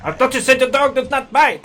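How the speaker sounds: high, hammed-up mock French accent; Peter Sellers French. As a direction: protest like Clouseau in fake French tones. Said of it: "I'm a Peter Sellers French".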